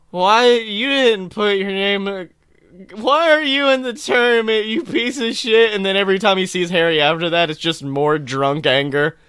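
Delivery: ordinary delivery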